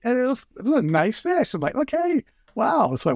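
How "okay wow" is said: high, slow amazed exhale; amazed wow. Retold or imitated. Retelling his first catch on the fly, slow high amazement. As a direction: savor it in disbelief.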